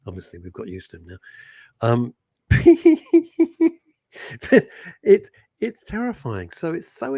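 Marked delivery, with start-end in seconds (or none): 2.5-3.7 loud high-pitched laugh. Real, not acted. Co-host breaks off talk of stand-up, high laugh. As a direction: burst out in loud, high giggles.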